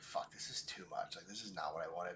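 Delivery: low muttered grumble under his breath; muttering to himself. Retold or imitated. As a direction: grumble it quietly to yourself. Retold frustrated inner thought.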